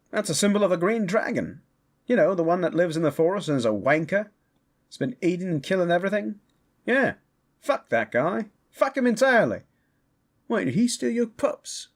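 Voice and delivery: high, agitated, rapid grumbling; indignant rant. Acted. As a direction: rattle off a grudge, pitch climbing. Summoned character rants about hated dragon.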